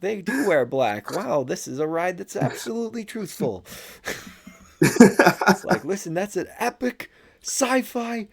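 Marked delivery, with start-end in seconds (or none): 0.0-3.6 high, far put-on dopey voice, laughing through; mock clueless tourist. Mocking a clueless moviegoer. 5.8-8.3 very high, slow put-on voice; mock film buff. Mock snobby film buff dialogue.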